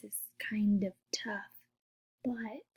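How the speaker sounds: hushed, confiding whisper; whispered admission that it's hard; whispered aside. Real, not acted. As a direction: whisper it like a little secret. Admitting forgiveness is hard.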